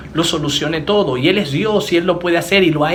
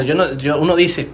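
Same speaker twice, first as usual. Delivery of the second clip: ordinary talk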